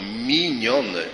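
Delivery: Minione set apart as its own word, firm falling tune; stress on Minione. Shown as a demonstration. Preacher stresses to the congregation: weight on Minione. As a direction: lean into Minione as the key word. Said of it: "Podkreślam z całą mocą".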